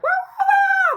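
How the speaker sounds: huge high, loud wah wah wah yell across the atrium. Retold or imitated. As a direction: belt it out so it echoes. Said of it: "I'm, like, yelling".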